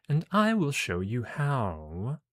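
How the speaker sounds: slow, drawn-out mentor line; mentor character. Acted. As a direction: stretch it out like a teacher promising a demonstration. Mentor voiced in game story.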